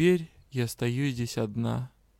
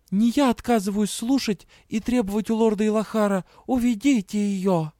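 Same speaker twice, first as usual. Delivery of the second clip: mocking imitation; 8 semitones higher, 4 dB louder than usual. A narrator's reading. Heroine imitates someone.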